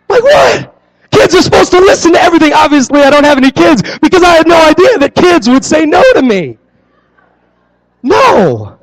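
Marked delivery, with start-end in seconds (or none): 0.1-6.6 high, fast, half-shouted disbelief. Real, not acted. Pastor recalling kids refusing his jumping jacks, incredulous shout. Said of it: "stunned at kids refusing".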